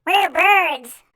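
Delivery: high, loud cartoon penguins. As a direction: pipe it up high like chirpy penguins. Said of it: "say the penguins".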